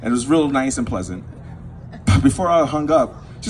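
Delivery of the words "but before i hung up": ordinary delivery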